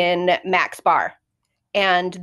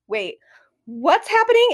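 confused gruff; 8 semitones higher than usual. Retold or imitated. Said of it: "he just kind of is like".

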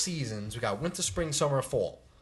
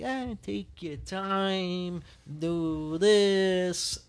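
drawn out, higher, easygoing drawl; laid-back out-of-towner; 5 semitones higher, much slower than usual. Retold or imitated. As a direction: stretch every word as if there's no hurry. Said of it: "when you go out other places, they're like".

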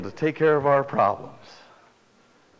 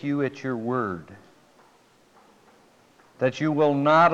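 prayer; 3 semitones lower than usual. Acted. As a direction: pray it gently over them. Pastor praying.

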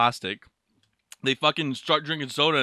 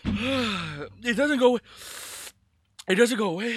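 pained whine; 8 semitones higher than usual. Real, not acted. Podcaster whose hot sauce burn lingers, whining in pain. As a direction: complain almost crying from the burn. Burn will not fade.

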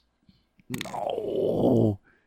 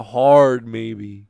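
slow drawn-out maybe; 7 dB louder than usual. Acted. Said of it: "joking emphasis".

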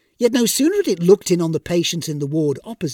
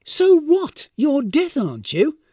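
high, louder burst of exasperation; irritated; 10 semitones higher, 5 dB louder, slower than usual. A narrator's reading. Irritated Seraph to a complaining Death, exasperated. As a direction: throw it back, impatient and irritated. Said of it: "said the irritated Seraph".

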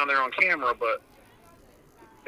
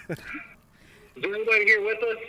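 raised, questioning voice toward the dark; calling out to spirits; 7 semitones higher, faster than usual. Real, not acted. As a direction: ask the empty room, voice lifted high. Ghost hunter calling to spirits.

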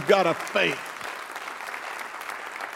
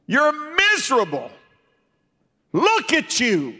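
Job's taunting wife; 6 semitones higher than usual. Acted. Preacher voicing Job's wife, taunting and high. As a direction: jeer at him as his scornful wife.

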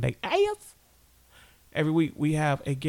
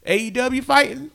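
hyped emphasis; 9 semitones higher, 4 dB louder than usual. Real, not acted. Hyping the fighter.

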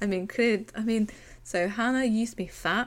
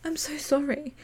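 mock apology; 6 semitones higher than usual. Mocking Ali's fake apology.

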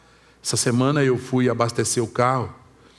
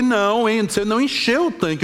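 higher-pitched retold telling-off; indignant complaint; 6 semitones higher than usual. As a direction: scold the attendant in mock indignation. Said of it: "não falei assim num tom tão baixinho". (bronca indignada, em tom mais alto)

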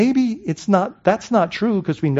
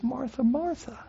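soft, gentle calling of her name; tender; 4 semitones higher, 10 dB quieter than usual. Retold or imitated. Jesus answering frustrated Martha, soft and tender. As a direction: say her name softly like a caress. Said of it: "very carefully and sweet and tenderly says".

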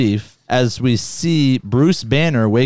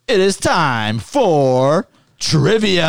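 slow grand announcer voice; 5 semitones higher, much slower than usual. Pretend. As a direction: proclaim it like a game show host. Trivia intro announcement.

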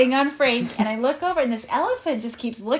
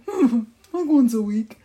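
lightly voiced animal reply, louder; animal character; 5 dB louder than usual. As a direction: answer as a storybook animal pondering. Frank answers in story.

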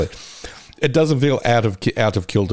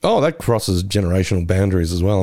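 usual speaking voice

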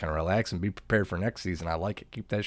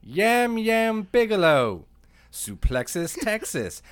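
town crier; 6 semitones higher, 5 dB louder, much slower than usual. Acted. Mock proclamation of nicknames.

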